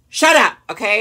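loud snapping outburst at the commenters; sudden shout. Real, not acted. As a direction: bark it out, fed up.

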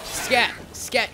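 high yelped scat. Real, not acted. Player shooing drowners with a high yelp. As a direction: squeak it at the pests.

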